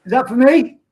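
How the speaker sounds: loud, high repeated call. Real, not acted. Streamer calling again to his son, loud and high.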